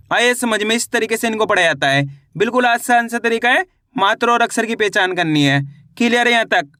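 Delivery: ordinary delivery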